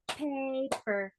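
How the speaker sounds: "Paper" with a long first beat, then the second; syllable-by-syllable "Paper"; split into syllables. Shown as a demonstration. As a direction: clap out "Paper" as pa, per. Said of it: "How many syllables?".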